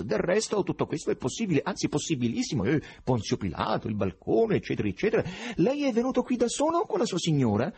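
high, eager and nervous; excited. A narrator's reading. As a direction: humor the madman brightly. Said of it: "diceva eccitato Berlioz".

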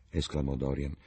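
plain reading voice

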